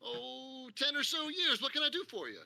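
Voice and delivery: put-on fifty-year-old shopkeeper proudly answers the newcomer. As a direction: boast about the shop with a chuckle.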